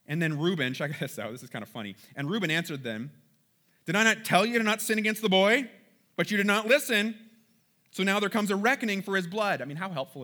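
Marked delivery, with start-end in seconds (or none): 3.9-9.6 raised, louder reproach; I-told-you-so scolding. Acted. Reuben answers his brothers over the boy, scolding I-told-you-so. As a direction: scold them, voice pitched up and forceful. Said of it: "I told you so".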